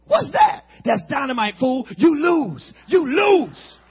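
loud mock taunt. Boy taunting his friend over the dynamite, loud mock taunt. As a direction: crow over your friend. Boys arguing over lighting it.

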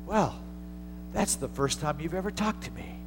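God's gentle small voice to the atheist. Retold or imitated. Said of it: "this gentle, small voice".

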